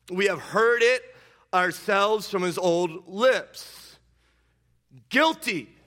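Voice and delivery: high, loud accusing shout; loud condemnation. Acted. Council condemning Jesus, loud and high. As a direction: cry out the verdict.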